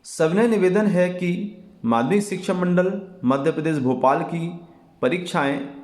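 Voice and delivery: ordinary talk, nothing marked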